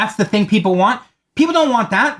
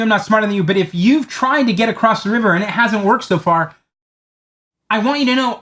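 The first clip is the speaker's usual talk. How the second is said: ordinary delivery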